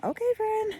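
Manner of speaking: high, mocking sing-song; sarcastic. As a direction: say it with a sarcastic sneer. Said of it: "You can use it sarcastically too".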